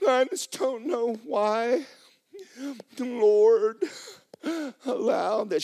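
high, slow self-pitying wail; mock depressed. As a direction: wail it slowly as a hammed-up complaint. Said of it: "you just sit around and you're depressed".

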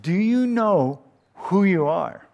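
a stern nanny scolding the little princess. Retold or imitated. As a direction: scold like a strict governess. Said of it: "scolded her nanny".

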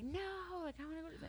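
high whiny kid protest, mock bawling; imitating crying kid. Aunt imitating nephew bawling when the TV goes off, high whine. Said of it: "he starts crying and bawling".

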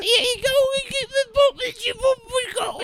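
very high, garbled mock-British babble; bad British accent. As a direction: squawk nonsense in fake British. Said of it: "not a British person doing his accent".

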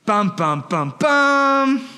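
mock dramatic sting, rising on the second pair; hammed-up suspense music; dun dun dun. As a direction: sing the suspense sting as a joke. Dramatic reveal of disciples' argument.

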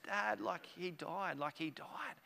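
hushed imitation of an upset little daughter; upset child. Upset daughter answering dad's question, softly mournful. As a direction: softly voice a child's hurt disbelief. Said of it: "she was so upset".

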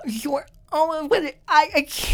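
high, halting fake amazement; mock flustered disbelief. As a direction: stammer in put-on astonishment. Acting flustered disbelief at the girl.

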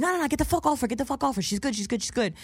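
high rapid-fire protest easing down; urgent intervention. Retold or imitated. Dude comes running to call off the bodyguard, urgent. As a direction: imitate frantic calling-off, then settle. Dude rushes in to defend her.

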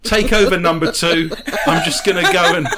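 loud, laughing captain's order to the first officer; mock captain. Captain rushing off to the toilet, loud laughing mock order. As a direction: bark the handover through laughter.

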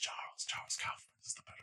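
quick hushed whisper; breathless stage whisper. Acted. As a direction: whisper the answer fast like a secret. Whispered answer at license quiz.

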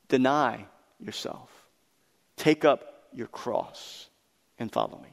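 slow solemn command. Acted.

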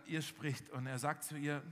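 usual speaking voice

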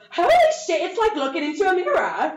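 imitating a loud, high Scottish exclamation; mock an astonished Scottish star shouting in delight; Scottish accent. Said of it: "in, like, her cute little Scottish accent".

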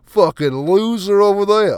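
high, loud sneering jeer; mock sneering onlooker. Imagined snob mocking a coatless guy, loud sneering jeer. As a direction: jeer like a snob pointing across the street. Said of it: "someone will be like".

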